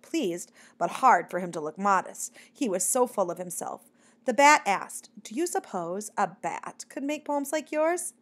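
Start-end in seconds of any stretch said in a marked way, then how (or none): 5.2-8.1 higher, slow, tentative asking; timid question. A narrator's reading. Shy bat asks the mockingbird about poems, tentatively. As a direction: ask it slowly and shyly.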